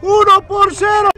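screaming, stretched goal call; breakneck goal commentary. Real, not acted. Goal call, huge shout.

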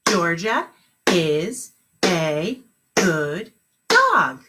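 very slow, each word spaced; clapped words. Shown as a demonstration. Teacher clapping the sentence for the class, very slow. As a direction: clap every word slowly. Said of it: "How many claps?".

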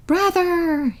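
long wolf howl of grief. A narrator's reading. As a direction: wail Brother like a wounded wolf. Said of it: "he howled".

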